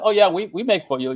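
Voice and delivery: lightly hammed Chinese accent; mock Chinese accent. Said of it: "the Chinese work this way".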